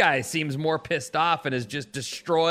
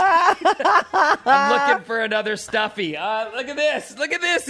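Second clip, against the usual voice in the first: giddy gushing; 12 semitones higher, 5 dB louder than usual. Retold or imitated. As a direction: melt with delight. Mimics hunting for cute toy.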